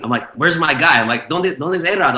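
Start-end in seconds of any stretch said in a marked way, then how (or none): 0.3-1.3 high, loud worried demand; urgent retold search, voice raised; mock panic. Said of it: "looking for him in a panic". 1.3-2.2 high-pitched frantic Spanish question; comic re-enacted desperation; mock panic. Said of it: "looking for him in a panic".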